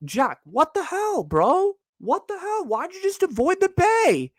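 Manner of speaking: squeaky, high-pitched what the hell; hammed-up disbelief aimed at Jack; incredulous high mock outrage. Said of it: "I'm like".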